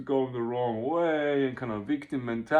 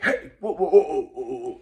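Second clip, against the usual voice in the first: sudden high, loud startled whoas; fight mode alarm; 5 semitones higher, 6 dB louder than usual. Retold or imitated. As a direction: jump up and push the threat back. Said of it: "the fight instinct that shows up like".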